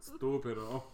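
a laughing mock Spanish "Stupido"; Spanish accent. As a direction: toss it off in a jokey Spanish accent. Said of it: "That was Spanish".

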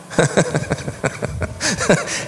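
quiet breathy run of laughter; chuckle. Real, not acted. Preacher after joking about smiles behind masks, soft chuckle. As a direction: laugh softly in a long rippling run.

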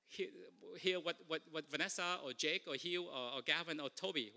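normal talking voice

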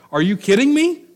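high, loud, disbelieving cry; a sudden leap up in pitch as if the whole question is absurd; incredulous angels. Acted. Angels at the tomb, baffled by Mary's weeping, incredulous.

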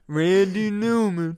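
[name] impression. Naming the [name] singer in a slow loud mock Newman voice. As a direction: drawl the name like the singer himself. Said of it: "the guy that sings that [name] song".

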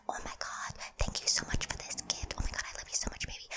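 put-on sweet whispered girlfriend roleplay; breathy pretend girlfriend; girlfriend ASMR whisper. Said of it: "people will do, like, pretend girlfriend one".

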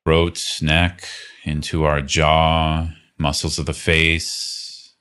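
calm, unhurried meditation guide voice; guided meditation. Acted. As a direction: gently lead them through the body scan with long pauses. Leads a guided body-scan meditation.

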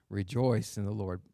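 low, softened "Rejoice in the Lord"; subdued verse repeat. Retold or imitated. Preacher repeating Paul's command to his church, low and subdued. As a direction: bring the verse down low and soft.

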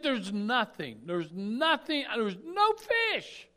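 slow, loud complaint rising in pitch; exasperated complaint. Retold or imitated. Fisherman complains of empty nets.

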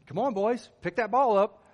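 put-on loud, high call to the boys; yelling encouragement from the dugout; coach yelling. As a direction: shout it across the field like a coach. Coach calling out to players.

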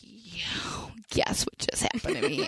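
hushed, giddy whisper; excited whisper. Retold or imitated. Telling Jess the gossip in an excited whisper. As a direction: whisper the big news breathlessly. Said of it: "I'm like".

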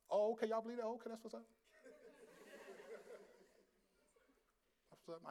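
soft noncommittal reply, fading to a mumble; acted-out quiet, awkward agreement; muted polite doubt. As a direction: go along with it under your breath. Privately doubting the hosts' theology.

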